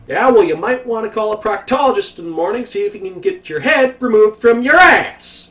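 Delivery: yelling back. Retold or imitated. Worker yelling an insult at the supervisor, high and loud. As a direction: yell the insult back over your shoulder. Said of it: "I yell at him".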